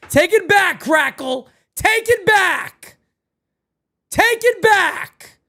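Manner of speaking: high, slow mock wail, take it back; hammed-up plea. Streamer begging a chat viewer, high hammed-up wail.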